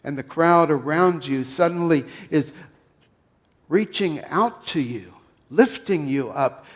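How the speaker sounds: ordinary talk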